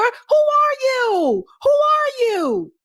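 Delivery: high, slow, incredulous "who are you"; mock disbelief. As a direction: stretch it out high in mock shock. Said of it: "You don't talk like that".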